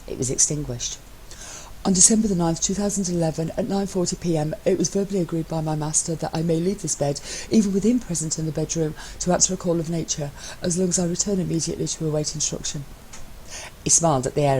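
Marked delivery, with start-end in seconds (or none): 1.8-12.9 lightly voiced teasing husband; a hint of smug, playful partner; lightly voiced cheeky spouse; Matt's voice. Matt's voice in the reading.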